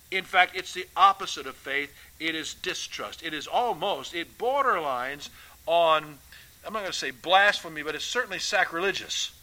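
ordinary talk

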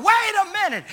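high mock holler of a clerk calling him back. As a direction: call after him, pitched high, half-acted. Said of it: "and she hollered".

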